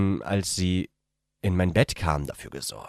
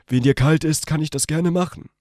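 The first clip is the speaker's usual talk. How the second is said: higher, eager male character voice; young man's voice; 11 semitones higher, 6 dB louder than usual. A narrator's reading. The young man offers the cold woman comfort, eagerly. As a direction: offer it warmly but nervously.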